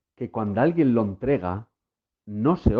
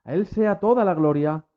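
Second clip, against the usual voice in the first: pitched far higher, climbing into fervent preaching; impassioned preaching climax; 9 semitones higher than usual. Real, not acted. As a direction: soar up with rising fervor. Sermon climax, rising fervor. (predicación apasionada y muy aguda)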